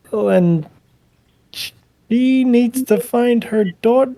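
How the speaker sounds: high, urgent plea for help; anxious pleading woman. Acted. Hilta begs the cleric to find her daughter, high and urgent. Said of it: "She's like".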